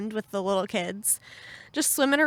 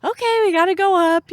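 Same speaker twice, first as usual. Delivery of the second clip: much higher and louder, mock-frantic; hammed-up urgency; 10 semitones higher, 7 dB louder than usual. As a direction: blurt it like you're panicking to leave. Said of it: "And then I was like".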